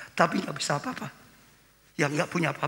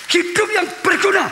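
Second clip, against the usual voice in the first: "Hidup yang berguna" shouted high and loud; preached shout; 14 semitones higher, 10 dB louder than usual. Acted. Pastor to congregation, shouting the key phrase. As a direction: hammer home "berguna" with full voice. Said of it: "repeated louder".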